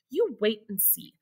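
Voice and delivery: hushed, teasing whisper; secretive whisper. A narrator's reading. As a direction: keep the surprise secret.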